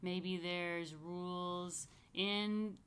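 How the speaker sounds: plain reading voice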